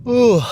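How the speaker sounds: high, loud yawning ooh. Real, not acted. Driver yawning at the wheel, loud yawn sound. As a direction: let a big yawn out. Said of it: "can't stop yawning".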